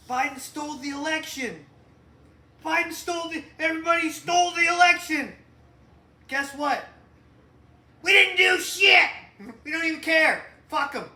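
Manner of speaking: high, loud ranting caricature; mock conspiracy ranter. Imitating people who say the election was stolen, shouting. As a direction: shout like an angry election denier. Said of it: "They go".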